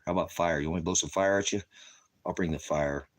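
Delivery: ordinary delivery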